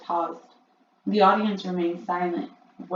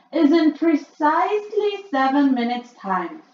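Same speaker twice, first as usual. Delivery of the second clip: much higher, louder witch voice; Grand High Witch; 8 semitones higher, 10 dB louder than usual. A narrator's reading. Witch addressing her audience, high and loud. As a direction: boom it out like a shrill witch queen. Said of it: "she said".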